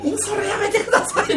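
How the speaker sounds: high-pitched plea broken by laughter; begging them to stop through giggles; laughing plea. Retold or imitated. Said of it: "って言いながら". (笑いながらの懇願)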